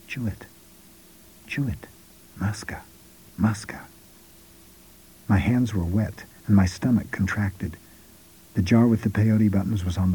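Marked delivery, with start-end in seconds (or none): 0.1-3.8 very soft, spaced-out repeated prompts; soft urging. A narrator's reading. Don Juan urging the hesitant narrator to chew, softly. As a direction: urge gently under your breath, pausing between each. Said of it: "Don Juan urged me softly".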